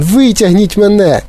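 high, desperate plea; frantic wailing. A narrator's reading. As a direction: beg to be pulled out, crying high. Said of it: "залементував він". (розпачливий хлопчачий лемент)

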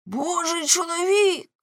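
high, drawn-out scornful cry; exclaiming. A narrator's reading. Husband mocking his wife's holy man, exclaiming. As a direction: throw the words back, high and slow. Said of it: "вигукнув Ламе".

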